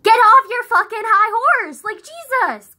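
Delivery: loud, high-pitched telling-off; exasperated outburst. Real, not acted. Ranting at someone on a high horse, exasperated outburst.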